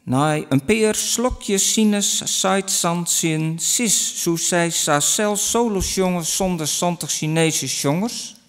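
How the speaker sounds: quick Frisian sentence full of S sounds; Frisian tongue twister. Shown as a demonstration. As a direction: rattle off the S-heavy Frisian line. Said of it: "In Frisian it goes like this".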